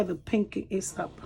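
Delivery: usual speaking voice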